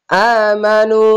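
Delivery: chanted tajweed reading; recited in a chanting voice; Quranic recitation. Shown as a demonstration. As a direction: recite it as in prayer. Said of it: "এক আলিফ টেনে পড়বেন".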